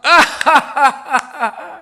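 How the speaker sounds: loud, high burst of belly laughter; big laugh. Real, not acted. 할머니 농담 뒤에 웃음. (크게 터뜨리는 웃음)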